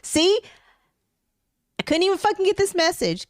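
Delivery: pissed all over again; ranting to husband about missed text; retold angry outburst. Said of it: "I'm pissed all over again".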